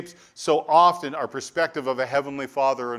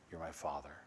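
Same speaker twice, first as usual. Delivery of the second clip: quiet declaration; 8 semitones lower, 16 dB quieter than usual. Acted. Personal declaration of faith.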